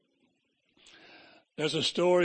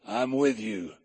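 a deep, easy reassurance from beside them; the Lord's voice; 8 semitones lower, 3 dB quieter, much slower than usual. Retold or imitated. The Lord comforts the three in the furnace, low and soft. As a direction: lower and softer, calm and reassuring. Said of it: "He said".

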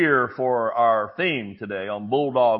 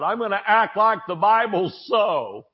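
higher-pitched defiant declaration, mildly shouted; mild mock yell, pitched up; retold shout; 6 semitones higher than usual. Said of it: "he just shouted out loud".

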